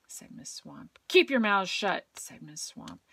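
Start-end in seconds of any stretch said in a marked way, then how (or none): 1.1-2.1 loud stern decree; stern teacher. A narrator's reading. Miss Swamp laying down rules, loud and stern. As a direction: flatly forbid it.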